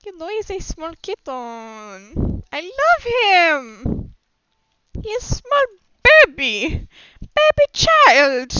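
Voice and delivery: high, drawn-out cutesy put-on voice; gushing baby talk. Fawns over their small kitten character, high gushing baby-talk voice.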